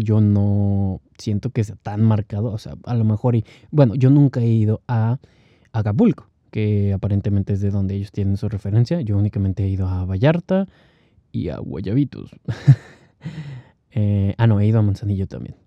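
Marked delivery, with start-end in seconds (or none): none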